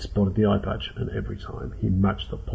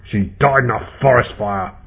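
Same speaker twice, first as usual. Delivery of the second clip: loud, snarling retort; forceful growled rebuttal; angry snarl; 5 dB louder than usual. A narrator's reading. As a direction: snarl it back at the accuser. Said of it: "snarled Eye Patch".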